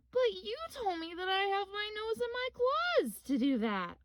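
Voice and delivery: high, puzzled cub protest; surprised. Acted. Little Bear puzzled by Grandma's answer, high surprised.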